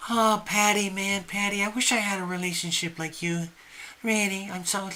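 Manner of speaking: put-on envious girlfriends. Girls telling Patty they wish for her relationship, soft gushing. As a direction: gush quietly like a friend envying Patty. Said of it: "the girls would say".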